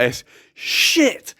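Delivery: high, panicked swear, retold; alarmed exclamation. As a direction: blurt it in mock alarm. Tells his alarmed reaction.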